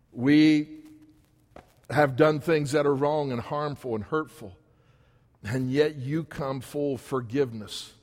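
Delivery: earnest pastoral prayer, rising and falling. Acted. Pastor praying over the congregation, earnest and heartfelt. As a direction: pray over the church with feeling. Preacher leads closing prayer.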